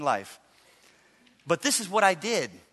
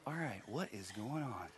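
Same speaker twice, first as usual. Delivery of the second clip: much softer and lower, murmured to himself; self-talk; 9 semitones lower, 18 dB quieter than usual. Retold or imitated. Pastor asking himself what is wrong, soft murmur. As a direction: mutter the question inward. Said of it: "I said to myself".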